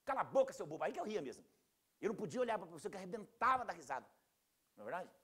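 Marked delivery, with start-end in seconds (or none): 0.1-0.8 loud, high telling-off; acted classroom reprimand, louder and higher; scolding teacher. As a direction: snap at a giggling pupil like an annoyed teacher. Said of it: "o professor fala assim".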